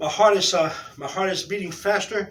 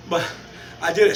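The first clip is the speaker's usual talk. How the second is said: ordinary talk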